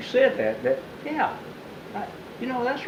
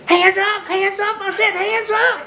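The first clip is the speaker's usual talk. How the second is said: high shouted command; second officer yelling, high frantic shout; 3 dB louder than usual. Retold or imitated. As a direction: yell it over and over. Officer yells.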